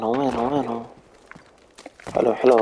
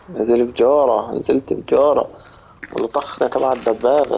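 normal talking voice

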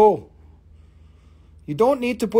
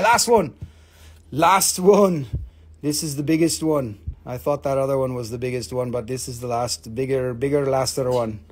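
ordinary delivery

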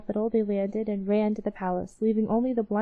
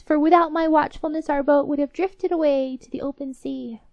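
boastful mouse; 5 semitones higher, 5 dB louder than usual. Acted. Blind mouse claims credit.

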